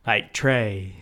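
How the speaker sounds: put-on "tray" said with no final T, as in the old BBC guide; old BBC pronunciation. Shown as a demonstration. Showing the old BBC way to say trait: "tray". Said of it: "you're not meant to pronounce the final T".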